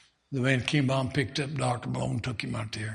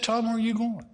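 higher-pitched wife's voice; a wife asking where he's going, voiced higher; worried wife; 8 semitones higher, faster than usual. Wife asking her husband where he's going, higher voice.

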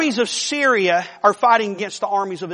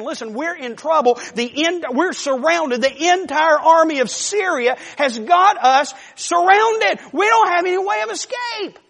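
building from urgent to a high frightened shout; a terrified servant yelling for help, rising in pitch; panicked scream; 7 semitones higher than usual. Retold or imitated. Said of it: "he starts screaming for Elisha".

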